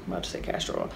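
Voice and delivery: catching yourself in a whisper; whispered aside. Real, not acted. Self-correcting aside.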